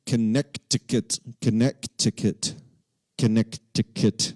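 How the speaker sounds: "Connecticut" with the middle c sounded, con-NECK-ti-cut; "Connecticut" broken up as con-neck-tih-cut, deliberately; spelling pronunciation. Shown as a demonstration. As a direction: sound out every hard c in "Connecticut". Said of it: "It's not Connecticut".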